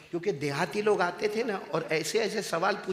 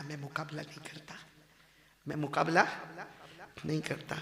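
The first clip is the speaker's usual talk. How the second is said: tearful restraint; 2 semitones lower, 7 dB quieter than usual. Acted. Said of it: "आंखों में आंसू आ गए".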